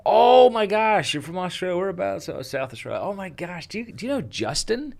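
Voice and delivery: high-pitched gushing American guy; hammed-up squeaky astonishment; imitated excited American. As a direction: mimic an over-excited fan, voice way up high. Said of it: "But he said".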